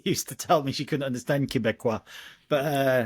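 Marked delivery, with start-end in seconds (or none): none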